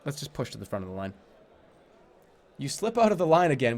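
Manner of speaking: ordinary delivery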